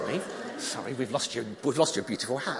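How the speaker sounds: ordinary talk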